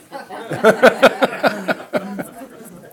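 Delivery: short burst of real laughter; laughing. Real, not acted. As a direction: laugh out at the memory. Laughs at own story.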